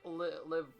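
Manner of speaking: ordinary talk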